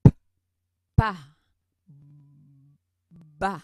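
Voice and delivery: teacher exaggerating "P, pa, ba"; exaggerated lip plosives. Shown as a demonstration. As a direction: overdo the lip pop on each syllable. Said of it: "je l'exagère".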